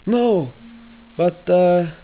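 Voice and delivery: high, loud put-on old-man accent; Geppetto's put-on accent. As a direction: answer as Geppetto in a hammed-up accent. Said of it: "I'm doing the wrong accent for this".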